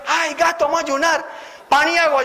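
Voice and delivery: high, eager friend's voice. Retold or imitated. Friend inviting him to fast on bread and water, high and eager. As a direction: pitch it up like a pushy pal inviting you.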